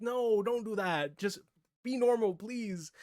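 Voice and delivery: high, slow begging protest; heated plea. Retold or imitated. Fan begging the show to stay normal, high and heated. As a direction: beg the show to stop, exasperated. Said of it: "I'm getting heated talking about it".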